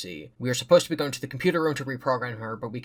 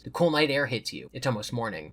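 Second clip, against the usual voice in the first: usual speaking voice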